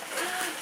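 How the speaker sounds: quick, high gasp of surprise. Real, not acted. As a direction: catch your breath at a find. Finding something more in box.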